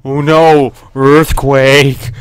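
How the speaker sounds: loud, hammed-up cry of alarm; playful loud warning; mock alarm. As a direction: call out a disaster in fake panic. Ground shakes, player mocks alarm.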